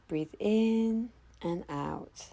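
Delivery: slow drawn-out breathing cue; Wim Hof breathing cue. Shown as a demonstration. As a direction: stretch each word like a long breath. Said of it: "let's get into round one".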